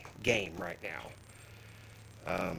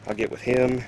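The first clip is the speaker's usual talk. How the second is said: ordinary talk, nothing marked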